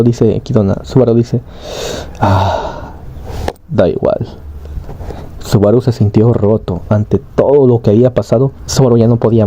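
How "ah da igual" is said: lightly acted weary sigh, low and soft; mild retold resignation; resigned. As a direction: give up with a sighed, understated shrug. Subaru broken, giving up.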